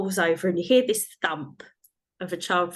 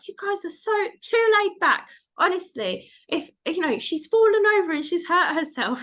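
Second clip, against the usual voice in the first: much higher, scolding imitation; fussing mother-in-law; 11 semitones higher, 4 dB louder than usual. Mother-in-law scolding the laid-back parents, high mimicked fussing. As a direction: mimic a worked-up relative in a high voice. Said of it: "and she's like".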